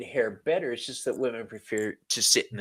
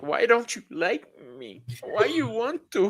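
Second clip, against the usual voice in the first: higher, whiny pleading; whiny mock plea; 11 semitones higher than usual. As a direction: plead like you feel rejected. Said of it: "I was like".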